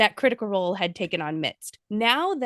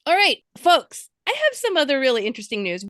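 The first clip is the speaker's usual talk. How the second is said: announcer intro; 5 semitones higher, 4 dB louder than usual. Acted. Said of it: "host announcement".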